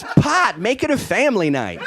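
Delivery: loud, high announcer shout; hammed-up commercial sign-off; mock ad slogan. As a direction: belt out the punchline tagline.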